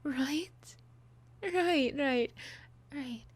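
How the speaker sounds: loud, possessive, jealous outburst; jealous lover snapping, laughing; unhinged villain. Acted.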